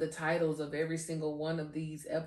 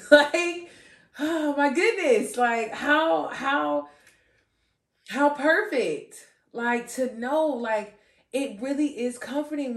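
higher and louder, bursting with delight; overjoyed; 8 semitones higher, 9 dB louder than usual. Real, not acted. Telling listeners how perfect God's love feels, overjoyed. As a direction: gush with joy, fresh from laughing. Overjoyed laughing outburst about God.

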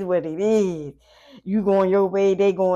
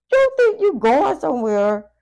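higher, louder sneering taunt; 9 semitones higher, 7 dB louder than usual. Acted. Reader voicing the ex sneering at the client, loud taunt. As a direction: call it out like a jealous ex.

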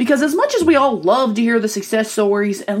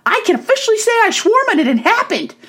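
proud; 9 semitones higher than usual. Retold or imitated. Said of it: "I was like".

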